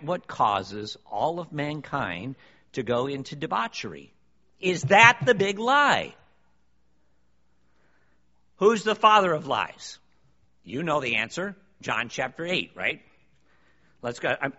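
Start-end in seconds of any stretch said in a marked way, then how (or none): 4.6-6.1 "Is that the big lie?" shouted higher and louder, with the stress on "that"; preaching shout. Real, not acted. Preacher to congregation, a full-force preaching shout. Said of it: "rhetorical question asked twice". 8.6-10.0 higher and louder, hitting "father"; preaching shout. Real, not acted. Preacher to congregation, a full-force preaching shout.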